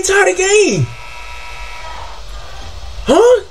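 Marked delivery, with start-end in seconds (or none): none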